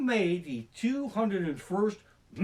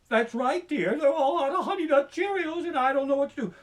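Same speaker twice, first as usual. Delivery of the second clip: high, whiny henpecked husband on the phone; whiny timid husband; 9 semitones higher, 4 dB louder than usual. Retold or imitated. A timid husband calling his wife from the cereal aisle, high and whiny. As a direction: whimper it to your wife like you're helpless.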